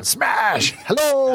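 high mock yell; mock shout. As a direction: shout it like a barging thug. GM mocks breaking door down.

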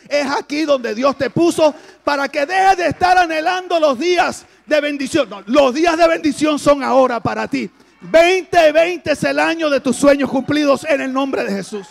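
loud climactic sermon shout; shouted preaching. Acted. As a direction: hit the climax full voice.

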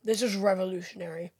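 dry sarcastic deadpan, low and soft. Acted. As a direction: say it sarcastically, without real excitement. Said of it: "I was being sarcastic".

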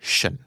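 ชั่น said as a light unstressed เชิญ; reduced shun. Shown as a demonstration. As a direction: say เชิญ soft and short, not ชั่น. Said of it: "มันจะไม่เคยเป็นชั่นจริงๆ แต่มันจะเป็น". (ออกเสียงเบาๆ สั้นๆ ว่า เชิญ)

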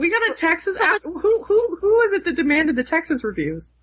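high, delighted squeal of surprise; thrilled, voice jumping up; excited. Real, not acted. Cohost hearing a Texas review arrived, excited and high.

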